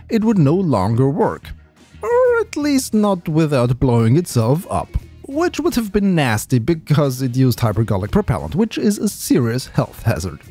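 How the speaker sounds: normal talking voice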